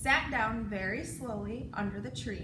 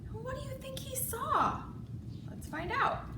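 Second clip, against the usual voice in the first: excited; 10 semitones higher, 4 dB quieter than usual. A narrator's reading. Asking child listeners.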